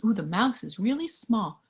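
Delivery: plain reading voice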